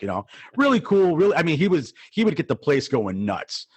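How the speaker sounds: ordinary delivery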